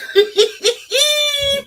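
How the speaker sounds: a high, disbelieving shriek of laughter. Real, not acted. Gossiping to viewers, a shocked high laugh. Disgusted laugh at grandfather dating.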